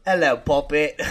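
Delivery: lightly attempted mock British greeting; playful, badly faked British accent; put-on British accent. Shown as a demonstration. American co-host trying British on request, put-on British accent. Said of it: "you do British and I'll do American".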